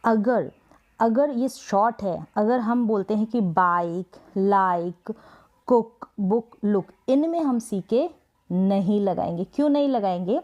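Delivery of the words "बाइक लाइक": बाइक and लाइक with a long, drawn-out "आई"; long vowel sound. Shown as a demonstration. Teacher showing pupils the long आई sound, बाइक and लाइक drawn out. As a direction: stretch the "आई" glide in बाइक and लाइक. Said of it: "आई sound long आ रहा है".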